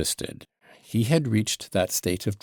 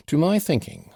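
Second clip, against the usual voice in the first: put-on higher, lightly perked-up old man; suddenly revived; 6 semitones higher, 3 dB louder than usual. A narrator's reading. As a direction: perk up a little on a favourite topic. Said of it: "he revived at once, seeming to grow sober".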